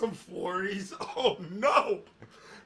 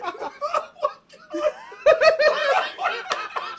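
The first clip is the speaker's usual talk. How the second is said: howling laugh; 6 dB louder than usual. Real, not acted. Friends howling at fanfic reading.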